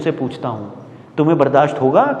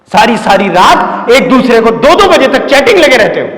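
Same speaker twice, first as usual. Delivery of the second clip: loud, raised-pitch teacher's telling-off; angry scolding; 5 semitones higher, 7 dB louder than usual. Acted. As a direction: lay into the class, loud and high. Teacher angrily scolds failed class. (गुस्से में ऊँची आवाज़ में डाँट)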